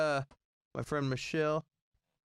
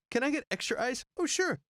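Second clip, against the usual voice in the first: high-pitched mock-polite voices; acted exchange; 10 semitones higher than usual. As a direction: act out request and reply, pitched way up. Replaying request and server's answer.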